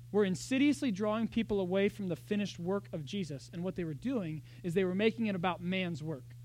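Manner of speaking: ordinary talk, nothing marked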